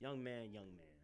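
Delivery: grumpy elder. Pretend. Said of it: "Respect the elders".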